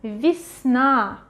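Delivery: Весна with е as i, stress on the final a; reduced unstressed Ye. Shown as a demonstration. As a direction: say весна as vis-NA for learners. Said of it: "pronounce as".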